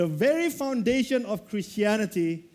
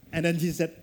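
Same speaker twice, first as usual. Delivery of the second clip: ordinary delivery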